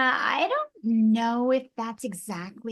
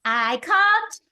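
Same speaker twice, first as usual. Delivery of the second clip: louder, higher character quip; Jack Hammer line; 8 semitones higher, 5 dB louder, slower than usual. Pretend. Recalling her iconic line, a bright loud quip. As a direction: punch it out brightly. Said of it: "I call Jack Hammer".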